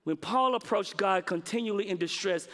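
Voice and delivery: normal talking voice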